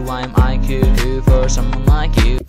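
normal talking voice

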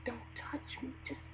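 near-whispered, tight plea to be left alone; barely voiced, touchy murmur; hushed warning. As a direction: hiss it under your breath, keep them away. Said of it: "You're like".

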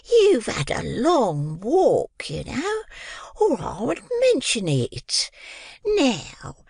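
high, slow whispered coaxing; sly whispered offer; coaxing Mrs Mann. A narrator's reading.

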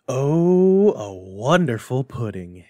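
a proud father marvels at the pudding; delighted exclamation. Acted. Father admires the Christmas pudding.

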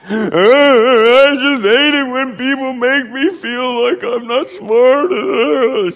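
high, loud, slow wail; imitated hysterical crying, stretched out; mock weeping. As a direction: sob the words out as if bereaved, hammed-up. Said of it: "somebody just died weeping".

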